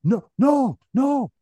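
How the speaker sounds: high, loud disbelieving noes; astonished. Retold or imitated. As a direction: imitate an over-the-top stunned reaction. Doctor's acted astonished reaction.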